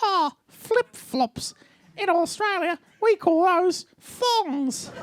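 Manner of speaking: very slow, high mock Australian accent; Australian wife. Wife corrects husband's word for flip-flops, mock Australian wife. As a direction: drag it out as a patronising Aussie wife. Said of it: "And she's like".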